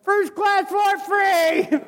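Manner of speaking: high, slow, laughing gloat; showing off his free upgrade in a high laughing drawl; gleeful crowing. Retold or imitated. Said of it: "I'm back in coach going".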